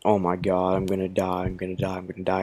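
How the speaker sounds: mildly acted inner panic, hushed; retold dread. Rider waiting for their turn, retold quiet dread. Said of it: "I could hear my heart beating".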